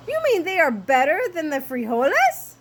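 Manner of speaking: high, loud kitten voice; amazed question. Acted. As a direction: ask it as an astonished little hero.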